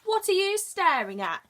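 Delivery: high, loud squeaky little bug voice; squeaky ladybird. Acted. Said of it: "squeaked the daddy ladybird".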